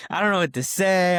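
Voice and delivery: high, loud mock sob through the words; teen sobbing to his dad, a touch hammed-up; retold bawling. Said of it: "bawling my eyes out".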